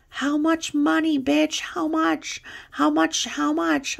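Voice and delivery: men shouting from cars. Retold or imitated. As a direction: bark it from a car window. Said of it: "They're like".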